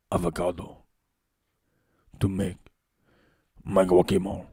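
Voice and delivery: low suave South American accent. Shown as a demonstration. Streamer playing a suave Latin man with his avocados. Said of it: "South American accent".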